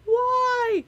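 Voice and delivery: high, loud exasperated cry of why; a comic, despairing high why. Retold or imitated. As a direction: throw up your hands at the stream and wail why. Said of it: "I'm like".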